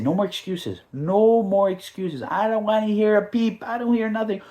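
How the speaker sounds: high, loud scolding of the fans; scolding rant. Real, not acted. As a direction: tell them off, no excuses allowed.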